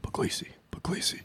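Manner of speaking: whispered practice to oneself; hushed, imitating a nervous host; rehearsing a name. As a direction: whisper it carefully under your breath. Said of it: "And they're like".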